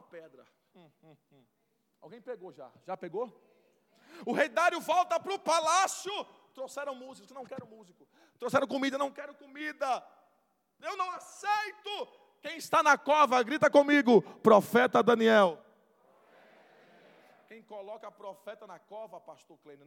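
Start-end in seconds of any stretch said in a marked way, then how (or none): 4.3-15.6 loud, high sermon climax; full-force preaching. Acted. Preacher telling of King Darius, loud preaching. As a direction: build the king's lament to a cry.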